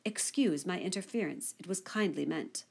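plain reading voice

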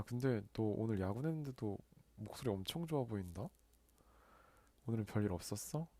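usual speaking voice

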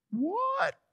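squeaky high-pitched baffled what; mother-in-law's high what. Retold or imitated. Preacher imitating his mother-in-law's startled high what. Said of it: "that's how my mother-in-law used to say it".

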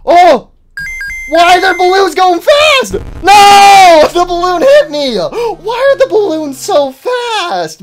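high, loud frantic shouting; panicked yelling. Real, not acted. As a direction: freak out at the balloons. Hit by fast balloons.

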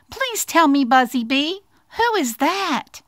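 high, eager little mouse voice; pleading little mouse. Acted. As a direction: ask it sweetly as a small mouse. Mouse questions the bee.

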